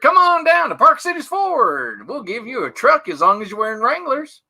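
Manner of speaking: high, loud hammed-up cowboy pitchman; twangy car-ad cowboy hollering. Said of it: "You asked for it".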